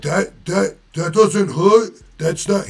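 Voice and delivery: high, loud, slow imitated Boston accent. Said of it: "he was from Boston".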